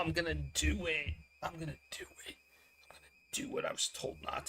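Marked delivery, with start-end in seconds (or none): 1.4-2.3 whispered under the breath; a conspiratorial whisper. Acted. Whispered repeat of own line. 2.9-4.5 hushed whisper, leaning in; guilty whisper. Acted.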